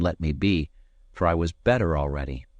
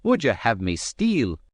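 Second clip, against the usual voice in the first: angry; 5 semitones higher than usual. A narrator's reading. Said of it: "same appearance of fury".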